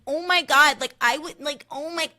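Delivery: high, loud defensive squeal; shrill defensive Amanda. Retold or imitated. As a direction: squeal in protest. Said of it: "so defensive and so shrill".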